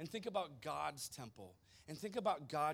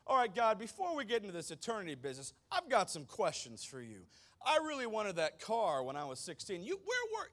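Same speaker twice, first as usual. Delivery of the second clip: mock cocky complaint, higher and louder; arrogant demand; 6 semitones higher, 7 dB louder than usual. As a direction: hammer God with entitled questions. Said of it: "that's how we're gonna start a conversation".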